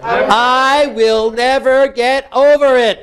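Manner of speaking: slow, drawn-out emphatic vow; modelled anguished anger. Shown as a demonstration. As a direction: stretch every word, leaning on NEVER. Intensified take for client.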